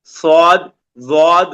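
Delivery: صاد and ضاد said full and heavy; heavy emphatic صاد ضاد; heavy letters. Shown as a demonstration. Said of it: "موٹا کر کے پڑھیں".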